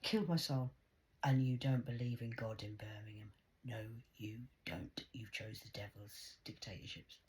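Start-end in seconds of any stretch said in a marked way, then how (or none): 1.2-7.2 hushed, breathy accusing whisper. Real, not acted. Woman whispering accusations at the city of Birmingham. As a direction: whisper it like a dark secret.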